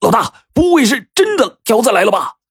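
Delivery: high, frightened question; startled, high-pitched alarm. A narrator's reading. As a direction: blurt out dawning panic to the boss. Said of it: "惊道".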